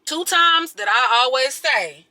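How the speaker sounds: ordinary talk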